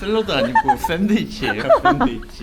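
loud, high laughing correction. Real, not acted. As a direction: laugh while correcting her. Laughing correction of wife's claim.

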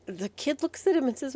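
ordinary delivery

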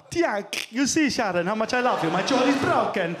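Italian lover talking through a slack, broken jaw; lovestruck Italian, dislocated jaw. Acted. Italian man telling Sharon his love, jaw-dislocated Italian voice. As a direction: declare love with your jaw hanging loose. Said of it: "Italian man will actually dislocate his jaw".

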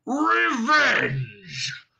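thundering dragon roar on Revenge!. Acted. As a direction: bellow it to shake the hall. Dragon roars in rage.

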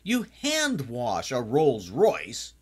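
high, mock-outraged yell at the screen; hammed-up scream of protest, drawing out the last words. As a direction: cry out in exasperated horror, playing it up. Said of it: "I'm just screaming".

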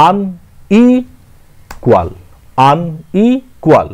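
আনইকুয়াল slowed right down, each syllable apart; teacher counting the syllables of আনইকুয়াল; syllable drill. Shown as a demonstration. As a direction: sound out আনইকুয়াল in three beats. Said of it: "তিনটে syllable আছে".

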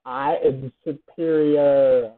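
slow, low, drawn-out gloating; mock-grand boast. Player gloating over a win in the game, mock-grand boast. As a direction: declare it grandly, stretching every word.

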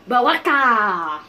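loud, drawn-out name shout; hammed-up call. Gamer spotting Baraka, hammed-up drawn-out call. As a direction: stretch the name out like a mock announcement. Calls out the character, then plainly.

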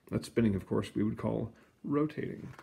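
usual speaking voice

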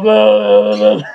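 low, loud, blubbery fish gabble; fish boss. Pretend. Angry fish boss gabbling at him, low and loud. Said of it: "he's going".

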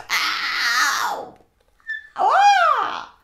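loud, high "ow" and "ah"; startled bird cry. Acted. Bird grabbed by hippo, crying out loudly in shock. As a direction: yelp as if suddenly grabbed. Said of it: "and the bird goes".

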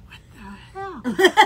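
loud, slow, drawn-out disbelief; shocked outcry. Retold or imitated. Seeing the friend with a cane in the bushes, stunned outcry.

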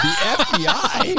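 high, slow, laughing disbelief; can't believe what he just heard; incredulous. Retold or imitated. Retelling his reply to the FBI threat, high laughing disbelief.

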